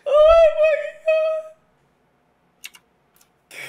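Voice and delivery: real excited screech, laughing; ecstatic shriek. Real, not acted. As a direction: scream it out in disbelief and glee. Shrieking at a video clip.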